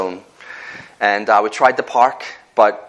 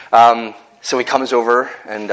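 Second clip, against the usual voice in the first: normal talking voice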